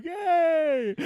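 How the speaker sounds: high, drawn-out mock greeting; imagined listener's holler. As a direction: call out as the delighted absent friend. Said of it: "He's listening too, going".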